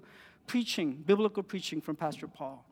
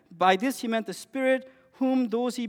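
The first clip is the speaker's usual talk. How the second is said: ordinary talk, nothing marked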